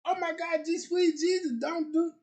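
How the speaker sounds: hammed-up anguish; dismayed wail. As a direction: cry out in mock despair. Dismay at imagined breakup.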